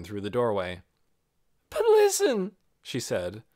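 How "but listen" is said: starting to cry. Acted. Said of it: "she really was starting to cry".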